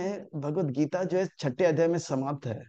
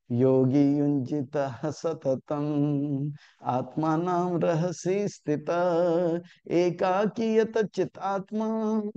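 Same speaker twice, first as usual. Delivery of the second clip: slow, louder chanted Sanskrit verse; श्लोक पाठ; 3 semitones higher, 4 dB louder, much slower than usual. Acted. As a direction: recite the shloka slowly, drawing out each word. (धीमा, सस्वर संस्कृत श्लोक पाठ)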